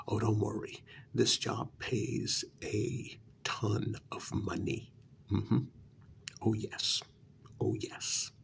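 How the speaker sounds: usual speaking voice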